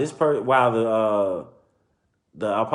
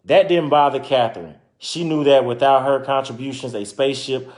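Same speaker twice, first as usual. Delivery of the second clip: story narration; 3 semitones higher, 5 dB louder than usual. Acted. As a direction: clear read-aloud storytelling voice. Reading picture book aloud.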